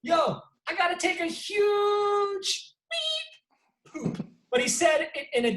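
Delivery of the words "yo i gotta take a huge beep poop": high, slow singsong mock voice; high, slow singsong imitation of a fighter; imitating the fighter. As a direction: croon it like a lazy brag. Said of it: "He goes".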